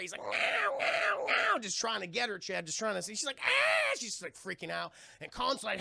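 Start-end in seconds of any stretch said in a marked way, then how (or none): 0.2-1.6 high, repeated creature squawks; imitated pterodactyl screech. Pterodactyl trying to grab Fay Wray, high mock screeches. 3.4-4.0 very high, loud shriek; hammed-up scream of fright; mock terrified scream. Fay Wray freaks out at the pterodactyl, high mock shriek.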